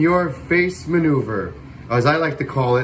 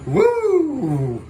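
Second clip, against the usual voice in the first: excited whoop; 10 semitones higher than usual. Real, not acted. Cheering the stunt jet pass.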